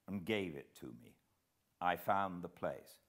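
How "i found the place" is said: ordinary delivery, nothing marked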